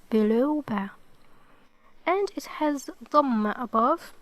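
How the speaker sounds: ordinary talk, nothing marked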